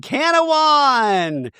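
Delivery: high, slow drawn-out cheer; mock cheer. The ladies cheering on arrival at Canawan. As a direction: stretch it out like a hyped chant.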